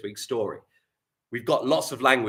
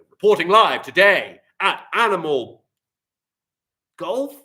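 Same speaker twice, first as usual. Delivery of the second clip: louder, rising to a high Golf; live reporter; 6 semitones higher, 9 dB louder than usual. Acted. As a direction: open like a TV sports reporter. TV reporter demo.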